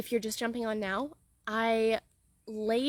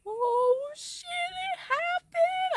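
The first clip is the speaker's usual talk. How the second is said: high, slowly drawn-out disbelief; shocked delight; much slower than usual. Retold or imitated. Coach imagining her first million dollar month, shocked and thrilled. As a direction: stretch it out in shock. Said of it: "I'm gonna be like".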